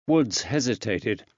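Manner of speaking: usual speaking voice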